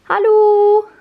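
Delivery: high, loud drawn-out hello to a cat; calling to pet. Real, not acted. Vlogger greets her cat Milka in German, high loud pet call.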